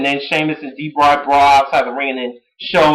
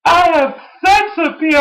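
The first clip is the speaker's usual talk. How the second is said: high-pitched mock boast; Daniel Bryan; 13 semitones higher than usual. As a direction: proclaim it high, imitating a cocky wrestler. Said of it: "I really laughed my ass off when he said this".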